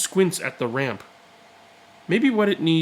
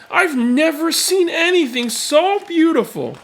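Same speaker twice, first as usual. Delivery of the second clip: high and loud in sheer wonder; an old man marvelling aloud; awed delight; 12 semitones higher, 4 dB louder than usual. Acted. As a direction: gasp it out, moved to tears. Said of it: "he says".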